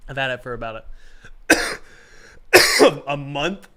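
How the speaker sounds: coughing through a cold; sick with a cold. Real, not acted. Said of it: "I got a cold today".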